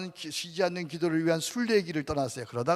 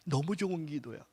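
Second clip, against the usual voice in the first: normal talking voice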